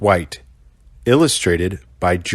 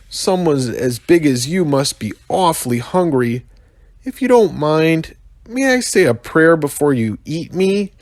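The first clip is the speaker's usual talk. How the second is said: put-on scared bush baby; 8 semitones higher than usual. Bush baby fears being eaten.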